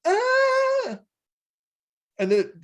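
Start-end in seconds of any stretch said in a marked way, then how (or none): 0.1-1.0 very high, loud yelp; mock cry. Imitating the character looking back, a high yelp. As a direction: yelp high like a startled robot. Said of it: "and he goes".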